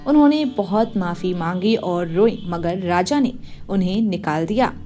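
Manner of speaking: ordinary delivery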